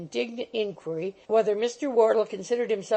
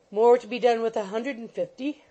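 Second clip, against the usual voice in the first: ordinary delivery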